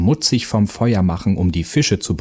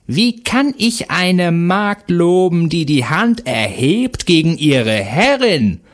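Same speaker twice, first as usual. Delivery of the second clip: high, slow witch voice, scornful; a hag looking down on a maid; haughty witch; 9 semitones higher, slower than usual. A narrator's reading. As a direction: Baba Yaga scorns the servant who raised her hand. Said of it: "fragte die Hexe".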